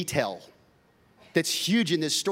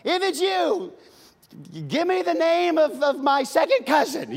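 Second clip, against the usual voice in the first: high, loud joking challenge; hammed-up doubtful demand; mock skeptical test; 12 semitones higher, 5 dB louder than usual. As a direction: quiz him like a suspicious relative. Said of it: "if I'm gonna say that".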